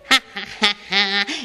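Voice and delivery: wicked witch cackle, rising; evil cackle. Acted. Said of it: "la conversation continuait".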